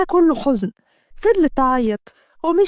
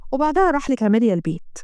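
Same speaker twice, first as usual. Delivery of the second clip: plain reading voice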